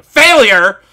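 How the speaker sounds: sudden loud burst on failure; outburst. Real, not acted. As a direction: blurt it out loud in a rant. Ranting about IGN.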